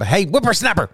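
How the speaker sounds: put-on cranky old-timer voice; old prospector. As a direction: scold like a crusty old man. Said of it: "some old man with his feet up".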